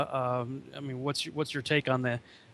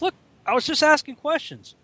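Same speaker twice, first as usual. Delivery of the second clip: high-pitched imitation of an excuse; mock innocent protest; 10 semitones higher than usual. Mimicking the author's escape from everything, mock innocent. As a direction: plead innocence in a put-on high voice.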